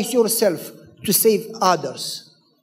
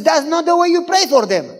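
high, loud fed-up correction; exasperated correction; 8 semitones higher, 4 dB louder than usual. Retold or imitated. Wife telling husband his prayer is wrong, exasperated. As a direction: tell him off.

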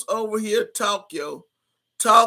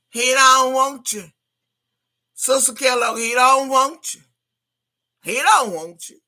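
loud, emphatic he don't want you; taunting; 4 semitones higher, 6 dB louder than usual. Real, not acted. Taunting Sister Kellogg from the chat with loud jeers. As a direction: rub it in, louder each time. Mocking a chatter's rival, repeated.

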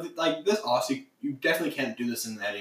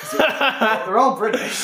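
mock British; 10 semitones higher, 5 dB louder, slower than usual. Teasing about British characters.

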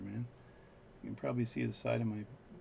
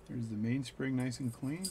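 ordinary talk

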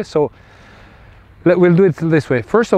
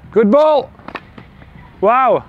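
excited praise; 7 semitones higher, much slower than usual. Real, not acted. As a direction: burst out with delight at the shot. Coach cheering a good rally.

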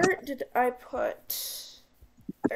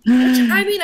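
loud; 11 dB louder, slower than usual. Real, not acted. Loud rant about weather.

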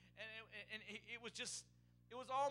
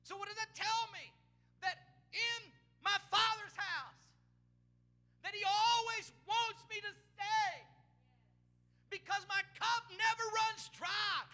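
loud, high preacher's shout with long drawn-out words; full-force preaching; 10 semitones higher, 10 dB louder than usual. Acted. Pastor preaching to the congregation, loud high shouting. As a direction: preach it full force, stretching each phrase.

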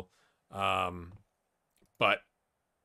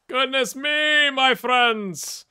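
much higher and louder, stretched slowly; loud dismayed cry; 10 semitones higher, 6 dB louder, much slower than usual. Real, not acted. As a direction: throw Goodness me up high and loud, slowly. Same words, now thrown up loud.

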